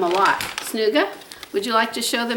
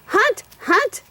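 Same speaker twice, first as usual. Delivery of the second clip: dog talk; 6 semitones higher, slower than usual. Real, not acted. Urging dog to hunt.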